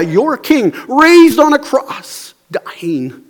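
high-pitched, loud, impassioned challenge; Jesus. Acted. Jesus confronting the offended crowd, impassioned rebuke. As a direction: confront them at full preaching force. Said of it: "It's like he's saying to them".

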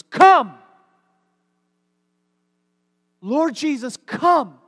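"Come! Lord Jesus, come!" shouted high and loud as an urgent command. Shown as a demonstration. Preacher showing the imperative, commanding shout. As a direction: cry it out like an order to heaven. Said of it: "It's a command, an imperative".

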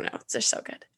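breathy whisper of delight. Real, not acted. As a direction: whisper it like a secret treat. Gushing about mocha drink.

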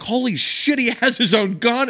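high, loud amazed cry; amazed troops. Retold or imitated. Troops amazed at Nugent's pistol, high amazed cry. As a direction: gasp it out in awe.

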